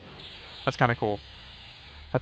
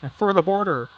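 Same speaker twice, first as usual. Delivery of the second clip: mock border patriot; 8 semitones higher, 3 dB louder than usual. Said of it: "I sound like somebody who's passionate about the southern border".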